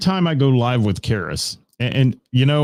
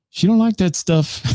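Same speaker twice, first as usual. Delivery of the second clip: pitched way up, put-on voice; imitating Stacy; 10 semitones higher than usual. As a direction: squeak it out in her voice. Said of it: "That's what she says".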